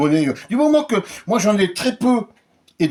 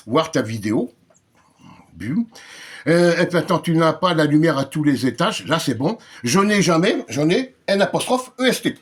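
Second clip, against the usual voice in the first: ordinary talk, nothing marked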